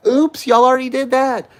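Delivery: high, loud, sing-song mock apology; mock oops. Real, not acted. Taunting friends who shunned her, sarcastic high oops.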